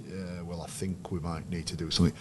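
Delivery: soft, half-hearted, noncommittal murmur; undercooked delivery. Shown as a demonstration. Coach showing how not to address players, undercooked and very quiet. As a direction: mumble it weakly, with no conviction. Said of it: "my delivery was a little bit undercooked".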